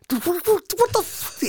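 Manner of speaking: a high, drawn-out, disbelieving "what the"; shocked outrage. Retold or imitated. Partner hears the wrestler might not show, shocked and slow. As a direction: let it rise in slow disbelief. Andrew reacts to no-show news.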